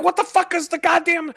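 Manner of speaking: a fed-up squeal at the phone; exasperated shriek. Retold or imitated. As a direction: shriek it out in mock fury. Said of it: "And you're like".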